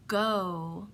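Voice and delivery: normal talking voice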